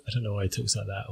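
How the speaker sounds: ordinary delivery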